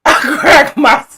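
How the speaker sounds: real, helpless laughter pushing the voice high; high squeals of laughter breaking up the words; cracking up. Real, not acted. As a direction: try to talk through a laughing fit. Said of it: "I cracked myself up".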